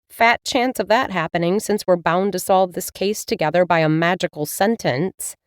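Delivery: plain reading voice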